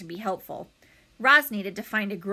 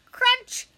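crunch sound; 8 dB louder than usual. A narrator's reading. Egg cracking open.

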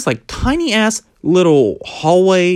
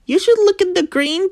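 mock guide voice. Quoting the escape room guy.

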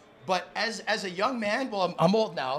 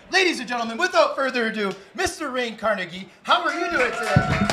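louder, higher showman intro; loud game-show host welcome; ring announcer hype; 3 semitones higher, 8 dB louder than usual. Acted. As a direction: bring the guest on like a title fight. Host announces second guest.

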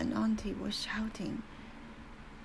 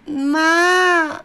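put-on a loud high child's cry for her mother; 9 semitones higher, 15 dB louder than usual. A narrator's reading. Little girl calls her mother across the room, loud and high. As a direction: shriek it, reaching for mama. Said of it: "I had cried".

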